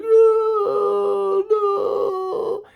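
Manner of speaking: long wailing mock falsetto; hammed-up high wail of dismay; imitating girlfriend. Pregnant girlfriend reacting to him, in an exaggerated falsetto wail. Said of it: "But she's like".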